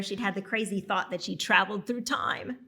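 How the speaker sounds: ordinary delivery, nothing marked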